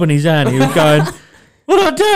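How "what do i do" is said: much higher, louder panicked squeal; a panicked boyfriend, in a high loud yelp; panicked plea. Retold or imitated. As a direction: squeal it like a man who has just hurt himself.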